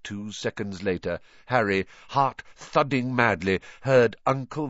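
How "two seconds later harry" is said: plain reading voice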